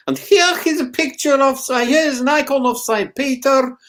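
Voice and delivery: high, mock guide voice; a hint of an insistent tour guide; quoted tour guide. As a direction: lightly play the Kremlin guide. Russian guide quoted in anecdote.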